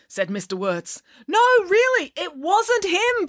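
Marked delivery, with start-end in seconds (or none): 1.3-3.3 high, drawn-out plea; desperate pleading. A narrator's reading. Counsellor begging father to release the boy, pleading. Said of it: "pleaded Mister Lang".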